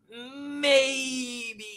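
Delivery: high, strained hopeful cry of maybe; a wishful fan's yelp. Retold or imitated. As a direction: cry it out like a longing fan. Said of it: "some part of me is just kinda like".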